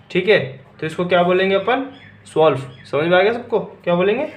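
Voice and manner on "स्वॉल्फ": स्वॉल्फ as German twelve, opening on "sw" with no t; silent t. Shown as a demonstration. Teacher modelling German twelve, स्वॉल्फ as "swolf" without t. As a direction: say स्वॉल्फ as one beat, "swolf", the t left silent. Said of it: "इसमें भी silent होता है ये".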